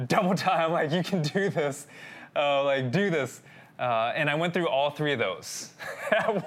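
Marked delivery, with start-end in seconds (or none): 0.1-0.9 loud, high drill-sergeant bark, laughing into it; mock army sergeant. Husband playing sergeant to his scared wife, barking commands. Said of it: "army sergeant her". 0.9-3.4 mock army sergeant. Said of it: "army sergeant her".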